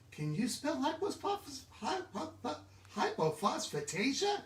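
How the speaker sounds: high, halting attempts at hypophosphatasia; stumbling over word. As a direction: trip over hypophosphatasia before getting it out. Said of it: "It's even hard to say".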